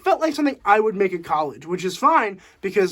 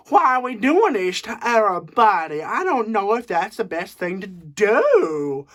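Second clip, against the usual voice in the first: bad Southern accent. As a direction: do a terrible mock Southern accent. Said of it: "talking like a person from the South in the worst possible way".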